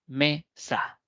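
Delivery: «mesa» split in two, me - sa, with a pause between; sílaba por sílaba. Shown as a demonstration. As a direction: say «mesa» as two separate beats. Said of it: "aquellas formadas por dos sílabas". («mesa» separado: me - sa)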